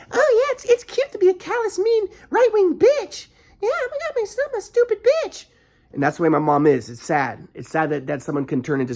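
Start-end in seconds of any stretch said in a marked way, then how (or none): 0.1-5.5 mocking mom. Son mocking his mother's callous attitude, high mock voice. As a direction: sneer her words in a high cartoonish voice. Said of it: "and she thinks".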